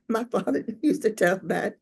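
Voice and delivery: real laughter breaking up the words; laughing. Real, not acted. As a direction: talk through your own laugh. Laughs after own punchline.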